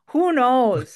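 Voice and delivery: broad Midwestern Wisconsin vowels; Wisconsin accent. Real, not acted. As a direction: say it with a thick Wisconsin twang. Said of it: "I just caught your Wisconsin accent there".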